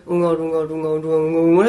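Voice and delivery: running mumble with barely moving mouth; mumbling imitation. Shown as a demonstration. Showing how Koreans talk without opening the mouth, mumbled. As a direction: imitate unstressed Korean, syllables run together. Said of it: "굳이 막 입 많이 안 벌리고".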